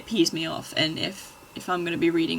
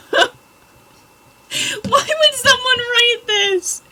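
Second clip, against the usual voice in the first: laughing disbelief; 10 dB louder than usual. Real, not acted. Reacting to cringey fanfic.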